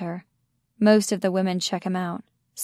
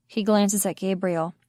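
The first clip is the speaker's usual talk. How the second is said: plain reading voice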